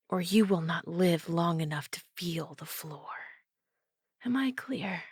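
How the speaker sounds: a low, cold warning under the breath; low menacing threat. Acted. Captor threatens the vampire with a low menace. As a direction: menace her captor quietly. Hostage threat, blade at throat.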